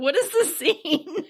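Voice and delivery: giggling at the film; laughing disbelief. Retold or imitated. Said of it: "I was laughing. I was like".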